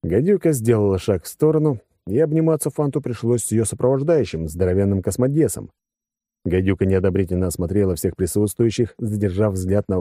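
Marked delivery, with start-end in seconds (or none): none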